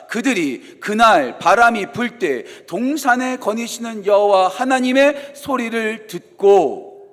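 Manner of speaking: slow, loud read-aloud of the verse; scripture reading. Shown as a demonstration. Pastor leading the congregation in reading scripture, slow and loud. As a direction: read the verse slowly and loudly together. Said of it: "같이 읽겠습니다".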